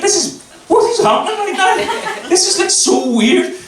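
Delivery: hammed-up, very high-pitched bewilderment; bewildered disbelief. Man retelling being booped by a stranger, high baffled voice. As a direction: play it baffled, voice leaping high.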